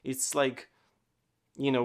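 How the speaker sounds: usual speaking voice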